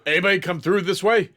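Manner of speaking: breathless guard raising the alarm. Acted. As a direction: guards halting mid-run, calling out in alarm. Guards rushing, alarmed about escape.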